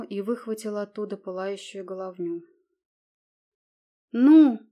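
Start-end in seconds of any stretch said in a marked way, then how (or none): 4.1-4.6 loud high Ну!; Ну! yelled defiantly; shout. A narrator's reading. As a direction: fling the challenge at them. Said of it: "крикнула она".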